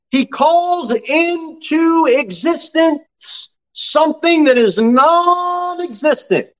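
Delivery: high, drawn-out revival preaching; impassioned preaching. Acted. Preacher proclaiming God's creation to the congregation, impassioned. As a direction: preach it high, stretching the key phrases.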